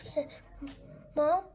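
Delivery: usual speaking voice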